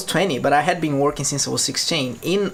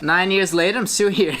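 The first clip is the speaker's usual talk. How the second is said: ordinary talk, nothing marked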